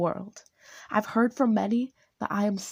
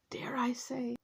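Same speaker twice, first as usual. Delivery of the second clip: whisper; 5 semitones higher, 9 dB quieter than usual. Real, not acted. Dramatic aside in intro.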